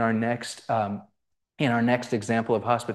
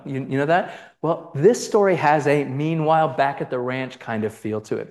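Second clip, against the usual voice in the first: normal talking voice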